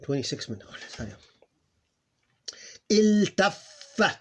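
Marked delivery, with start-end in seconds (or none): 2.9-4.2 Iltaffa said slowly, higher and louder; slow demo. Shown as a demonstration. Teacher slowly models Iltaffa for students. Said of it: "So it was like this".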